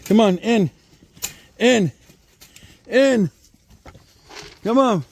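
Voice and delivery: short high calls, each falling away; herding call. Real, not acted. Farmer herding goats inside, calling out.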